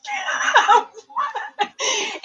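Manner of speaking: high, shrieking laugh; burst of laughter. Real, not acted. Host to contestant who guessed C, high burst of laughter.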